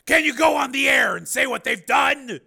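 high, loud, forceful question; pressing demand. A narrator's reading. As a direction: press for a yes at full volume. Steele presses Linda loudly.

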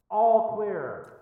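muffled call. Acted. Gray man calls to the boys across the basement, muffled. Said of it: "followed by a muffled call of".

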